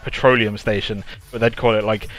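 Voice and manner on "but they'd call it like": usual speaking voice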